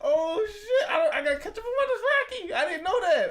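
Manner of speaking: loud, high, laughing disbelief; real astonishment, voice way up; amazed outburst. Real, not acted. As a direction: squeal it out, floored by the news. Amazed at learning what the word means.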